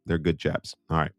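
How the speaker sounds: usual speaking voice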